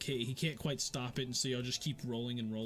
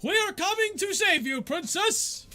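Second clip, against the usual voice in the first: high, loud heroic call to the princess; heroic shout; 10 dB louder than usual. Acted. Rescuer calling up to a captive princess, loud and heroic.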